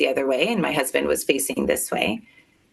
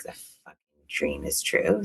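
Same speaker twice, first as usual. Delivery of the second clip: low, hushed inner voice; dropped low and quiet, a private dread; inner thought; 12 semitones lower, 5 dB quieter than usual. Retold or imitated. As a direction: say it under your breath, stunned. Inner panic during the doctor scan.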